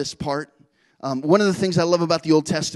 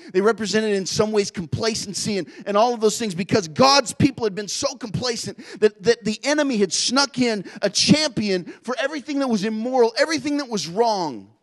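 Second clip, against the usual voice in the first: rising, louder sermon push; preacher climbing to a passionate peak; preaching at full force; 4 semitones higher, 3 dB louder than usual. Acted. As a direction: drive it home from the pulpit.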